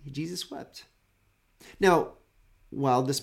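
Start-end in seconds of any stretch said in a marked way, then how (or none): 0.1-0.9 Jesus wept said much softer, almost breathed, ending on a rise; hushed repeat. Shown as a demonstration. Preacher repeats the famous short verse to the congregation, softly hushed. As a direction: let Jesus wept fall away to a hush. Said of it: "Two words".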